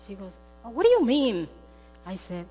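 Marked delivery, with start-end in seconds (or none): 0.6-1.5 loud, swooping question; baffled. Retold or imitated. Daughter puzzled by her mother's apology, baffled. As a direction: question it in raised disbelief.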